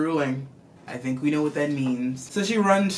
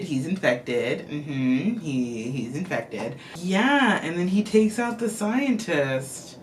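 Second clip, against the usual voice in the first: ordinary talk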